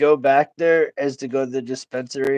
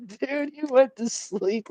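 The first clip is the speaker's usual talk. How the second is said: put-on high, slow, softer kid voice; innocent toddler; 10 semitones higher, 5 dB quieter than usual. Nephew explaining the accident, innocent and nonchalant. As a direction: imitate a nonchalant small child. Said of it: "he said it so innocently".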